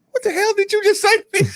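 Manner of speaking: high-pitched retold disbelief; mock incredulous. Player retelling his reply to the coach, mock incredulous.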